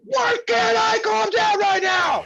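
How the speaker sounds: very high, drawn-out frustrated wail; hammed-up meltdown, slow and high; mock pissed-off shout. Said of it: "when I'm pissed off".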